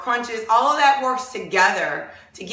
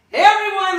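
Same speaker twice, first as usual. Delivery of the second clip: calling out; 8 semitones higher, 7 dB louder than usual. Real, not acted. Calling out to the class.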